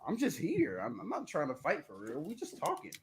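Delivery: hushed, high imitation of backing down; mock backing down. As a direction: play someone meekly backing out of a fight, very softly. Acting out someone backing down.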